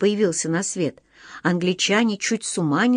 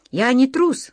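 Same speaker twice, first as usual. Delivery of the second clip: put-on higher, indignant boy's protest; indignant flare-up; 6 semitones higher, 3 dB louder than usual. A narrator's reading. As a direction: flare up at the insult. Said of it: "вспыхнул Серёжа".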